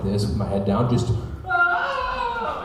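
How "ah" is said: thin mock scream of a bawling child; imitated screaming. Tiny kid walking home head down, imitated screaming. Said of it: "Like, screaming".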